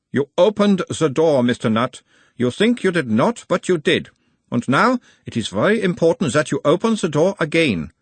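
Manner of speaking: put-on high, probing analyst in Uberwald accent; Uberwald-accented therapist, pitched well up; mild Uberwald accent. A narrator's reading. Nutt as analyst asks about his mother, Uberwald accent. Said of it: "asked in a mild Uberwaldian accent".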